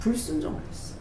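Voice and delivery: whispered, almost secret verdict; hushed whisper. Retold or imitated. Preacher whispers the hidden cause to the congregation. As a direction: drop to a whisper to reveal the sin. Revealing why prayer failed.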